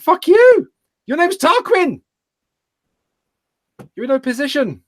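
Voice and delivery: high-pitched mock-outraged retort, loud at the start; indignant outburst. Streamer retelling his comeback to a heckler, mock outrage. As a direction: yell back in exaggerated outrage, voice shooting up.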